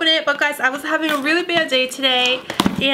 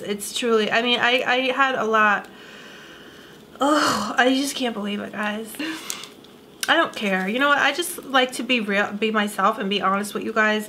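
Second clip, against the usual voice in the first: ordinary delivery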